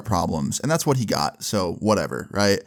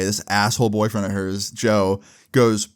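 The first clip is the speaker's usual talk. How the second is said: plain reading voice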